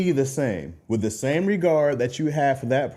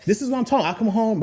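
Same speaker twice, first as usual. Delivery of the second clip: ordinary delivery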